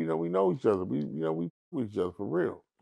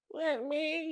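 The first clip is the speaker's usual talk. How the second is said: high, put-on tough-guy voice; fake tough guy act; 7 dB quieter than usual. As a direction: puff up like a little fake pimp. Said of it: "he went into his little act".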